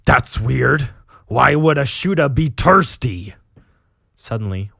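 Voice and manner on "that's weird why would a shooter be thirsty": confused orc, loud. Acted. Confused orc captain drinking oil, orc voice. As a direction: wonder aloud as an orc boss. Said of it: "you read an orc talking".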